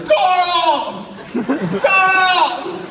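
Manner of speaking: high, loud, desperate calling; hammed-up Walking Dead shout; yelling for Carl. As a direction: shout the name across the market. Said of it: "one dude running around like".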